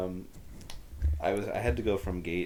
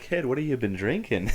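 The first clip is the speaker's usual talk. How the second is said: higher, louder Boston surgeon; mock Boston accent; 6 semitones higher, 5 dB louder than usual. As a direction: question the patient in a joking Boston accent. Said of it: "if your heart surgeon was like".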